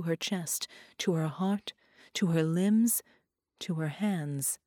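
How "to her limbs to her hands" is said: plain reading voice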